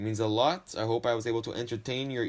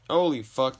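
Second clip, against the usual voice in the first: surprised; 3 semitones higher, 7 dB louder than usual. Real, not acted. Notices podcast length, exclaims.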